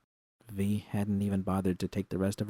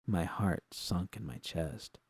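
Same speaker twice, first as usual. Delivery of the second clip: plain reading voice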